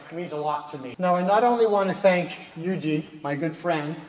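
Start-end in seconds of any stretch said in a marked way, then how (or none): none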